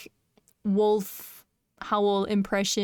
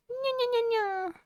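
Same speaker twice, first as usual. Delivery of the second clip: very high soft nyas; cat voice; 13 semitones higher, 3 dB quieter than usual. Pretend. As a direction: meow like a kitten. Cat meows on stream.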